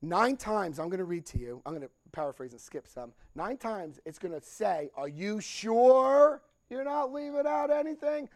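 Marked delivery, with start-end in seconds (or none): 4.9-8.3 mock probing voice. Book's question read in mock voice.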